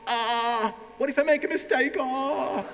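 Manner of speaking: high-pitched mock panic; hammed-up anxious squeal; blocked, panicking learner. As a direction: wail it like a learner frozen by fear of mistakes. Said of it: "A lot of people are blocked".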